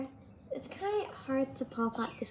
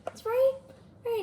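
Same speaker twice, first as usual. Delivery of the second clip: high squeal; 12 semitones higher, much faster than usual. Pretend.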